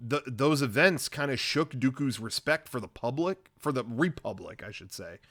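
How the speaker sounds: ordinary talk, nothing marked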